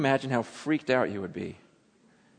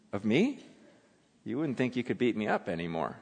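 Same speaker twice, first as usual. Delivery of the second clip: much softer, intimate voice; hushed imagining; 7 semitones lower, 9 dB quieter than usual. Real, not acted. Preacher quietly asking congregation to picture a healing, soft. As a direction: draw them in, almost confiding. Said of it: "preacher lowers voice to imagine miracle".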